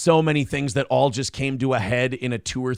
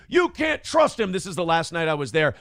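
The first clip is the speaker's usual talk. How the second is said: hammed-up furious tirade; mock yelling; 7 semitones higher than usual. Nick yelling at Kayla that liars lie, mock yell. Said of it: "he's yelling at her".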